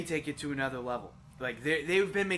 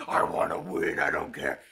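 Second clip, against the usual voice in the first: very low, monstrous imitation; deep mock-villain voice; mocking brute voice. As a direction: play a greedy beast, far below your voice. Mocking friend as greedy player.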